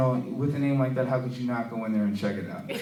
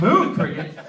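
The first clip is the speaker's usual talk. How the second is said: mildly incredulous, raised repeat; baffled echo; 5 semitones higher, 7 dB louder, slower than usual. Acted. Puzzled new coworker reacting to the blurt, baffled echo.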